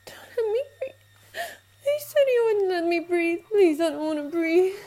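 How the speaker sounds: tearful begging, voice breaking; crying plea. Acted. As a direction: beg through sobs.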